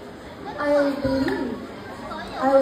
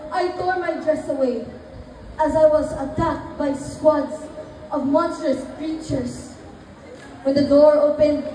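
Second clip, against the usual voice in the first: ordinary delivery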